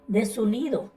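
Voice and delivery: ordinary talk, nothing marked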